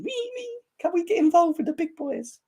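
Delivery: put-on very high, squeaky childish pleading; mock a little kid begging to join; whiny little kid. Mocking Arsenal fans as little kids, squeaky whine. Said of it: "like little kids, trying to get attention".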